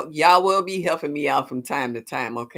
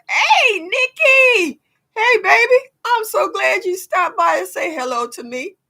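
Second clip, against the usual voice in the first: excited greeting; 9 dB louder than usual. Real, not acted. Greeting viewers in high voice.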